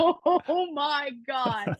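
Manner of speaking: very slow, drawn-out mock dread; stunned dismay. Skater seeing her old rival return, stunned dismay. Champion walks back in, she is stunned.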